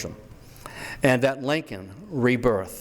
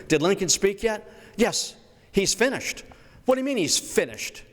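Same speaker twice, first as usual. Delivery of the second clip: much higher, bewildered back-and-forth; comic bystander dialogue; 7 semitones higher than usual. Pretend. Reporter grills a bystander, much higher and bewildered. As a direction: play flustered onlookers who missed it.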